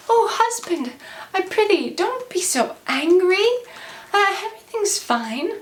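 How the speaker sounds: high-pitched placating wifely voice; acted sweet entreaty; pleading wife. Kate pleads with angry husband to calm.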